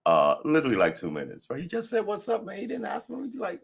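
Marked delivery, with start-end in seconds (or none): 1.6-3.6 high-pitched indignation. Real, not acted.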